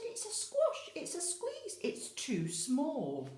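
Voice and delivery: put-on high, loud old woman's wail; fussy old lady. Old lady complaining to the wise man, high fretful voice. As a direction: whine it like a cramped old lady. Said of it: "She said".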